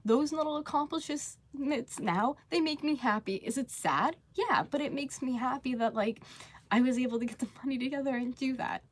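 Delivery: real happy tears, voice pitched high; tearing up. Real, not acted. Streamer telling viewers about small money wins, tearing up. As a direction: talk through welling tears, high-voiced. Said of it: "Like, I'm tearing up now".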